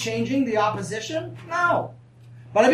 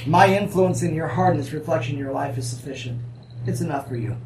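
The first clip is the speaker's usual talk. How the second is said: lower, gentle fatherly reply; voice of God; 6 semitones lower than usual. Retold or imitated. Preacher voicing God's gentle answer to Paul, lightly voiced. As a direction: let God speak to his son calmly, with a hint of a fatherly tone.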